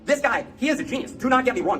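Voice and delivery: ordinary talk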